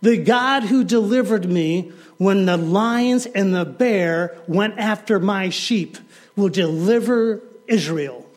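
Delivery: loud, high proclaiming; bold declaration. Acted. David to Saul, loud and defiant. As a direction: stand tall and declare it to the king. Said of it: "he says".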